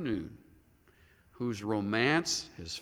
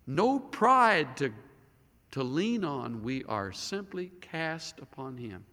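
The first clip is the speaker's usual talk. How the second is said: ordinary talk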